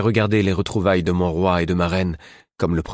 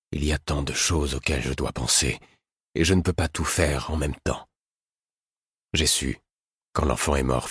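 grave; 3 semitones lower than usual. A narrator's reading. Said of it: "fit-il d'un ton grave".

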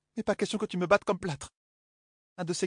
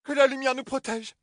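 high, louder cry of shock; exclaiming; 8 semitones higher, 4 dB louder than usual. Acted. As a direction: cry out in dismay. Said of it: "s'écria-t-elle". (s'écrier, choquée)

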